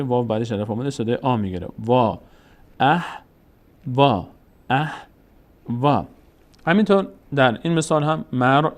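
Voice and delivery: ordinary talk, nothing marked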